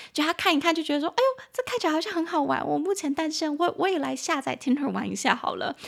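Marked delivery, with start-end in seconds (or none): 1.1-5.7 raised pitch, quick and excited, imitating a friend; friend's excited voice. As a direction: act out the friend getting hooked on the idea. Friend reacts to matchmaker feature.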